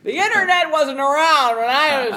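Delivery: put-on high, loud creaky old-timer; old person voice. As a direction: play it like a crotchety old-timer. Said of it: "that was my old person voice".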